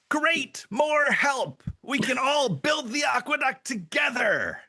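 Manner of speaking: a cheerful, gushing simpleton thrilled by visitors; bright, squeaky eager voice pitched well up; eager Hank. Acted. Hank greets the newcomers.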